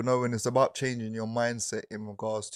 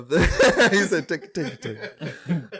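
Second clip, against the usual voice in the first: laughing; 3 semitones higher, 7 dB louder, slower than usual. Real, not acted. Laughing while telling.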